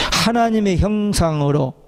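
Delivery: usual speaking voice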